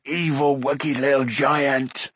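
slow, strained creature voice, indignant; mild shout in an odd creature voice; shouting Gurgi. A narrator's reading. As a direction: creature crying out at a foe. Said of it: "shouted Gurgi".

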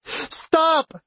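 very high, anguished yell; desperate cry. A narrator's reading. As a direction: cry out to make it end. Banging fist on the door.

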